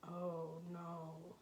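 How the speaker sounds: usual speaking voice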